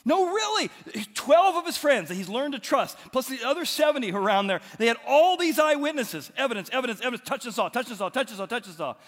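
very high, loud, fast breathless news; excited disciples. Acted. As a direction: blurt it out, bursting to tell. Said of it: "they're like".

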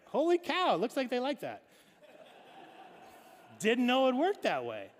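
high, loud, amazed exclamation; mock astonishment. Pastor jokingly voices God surprised by Adam and Eve, loud amazement. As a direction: play a startled onlooker caught off guard.

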